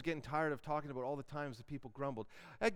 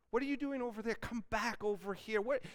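higher, exasperated calling out; calling to sheep; 7 semitones higher than usual. Acted. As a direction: call the stray sheep back, half annoyed. Shepherd calls straying sheep.

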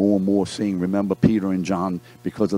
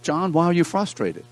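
counselor asking; 9 semitones higher than usual. Acted. Counselor asks John, role-played.

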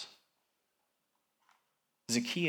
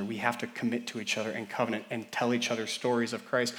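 ordinary delivery

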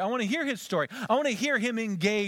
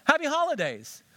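bright cheerful greeting; 9 semitones higher, 3 dB louder than usual. Shown as a demonstration.

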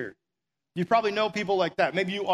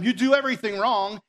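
higher, louder scolding blast; yelling at them; 5 semitones higher, 6 dB louder than usual. Retold or imitated. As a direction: bark blame at them. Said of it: "yelling at them".

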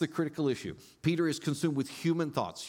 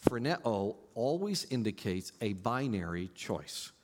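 normal talking voice

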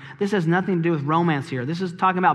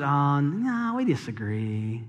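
calm godly reply; 6 semitones lower, 8 dB quieter, slower than usual. Retold or imitated. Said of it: "they just smile and said".

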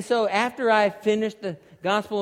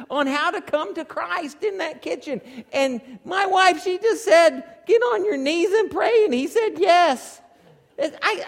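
high-pitched, loud comic griping to God; exaggerated aggrieved whine, pitched way up; mock complaining; 9 semitones higher, 5 dB louder than usual. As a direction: plead your case in hammed-up grievance. Acts jealous complaint to God.

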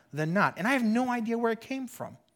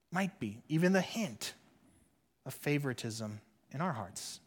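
hushed reflection; 6 semitones lower, 8 dB quieter than usual. Acted. Sermon, hushed reflective run.

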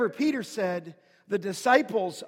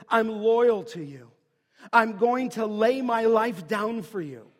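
louder, firm pledge of devotion; loyal declaration; 5 dB louder than usual. Retold or imitated. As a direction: declare loyalty out loud, as Peter to Jesus. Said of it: "I think he was saying to Jesus".

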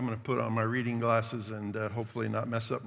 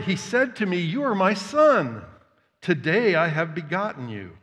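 loud, measured public Bible reading; declaiming scripture from the pulpit; 3 semitones higher, 4 dB louder than usual. Real, not acted. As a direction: proclaim the psalm to the room. Reads Psalm aloud.

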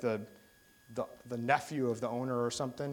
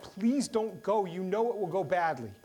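pleading disciples; 6 semitones higher, 3 dB louder than usual. Retold or imitated. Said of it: "the disciples have said".

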